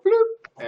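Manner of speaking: high squeaky bloop; a quick cartoon pop for the swipe; pop sound effect. GM makes a silly pop as the thief grabs.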